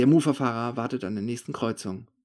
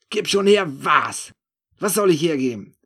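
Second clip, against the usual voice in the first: loud, incredulous echo of the demand; baffled loud question; 5 semitones higher, 6 dB louder than usual. A narrator's reading. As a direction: throw his words back at him, baffled. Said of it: "frage ich ihn". (laute, verblüffte Gegenfrage)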